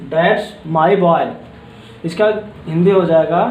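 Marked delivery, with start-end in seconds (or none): 0.1-1.3 put-on that's my boy drawn out slowly; slow, proud that's my boy; slow proud praise. Shown as a demonstration. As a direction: say it slowly, full of pride. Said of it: "अब हमारा छठा word है".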